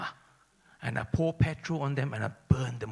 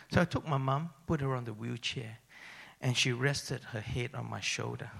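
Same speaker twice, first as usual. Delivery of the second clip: usual speaking voice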